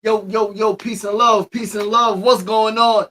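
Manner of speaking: loud, high, hyped-up shout-out; hype greeting. Real, not acted. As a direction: pump the crowd up with a shout. Second hype greeting to viewers.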